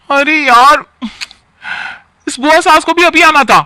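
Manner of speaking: high, loud and fed up; exasperated outburst. Acted. Garima to Rajeev about the aunt: loud, high, exasperated. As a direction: blurt out your annoyance at the unwanted guest.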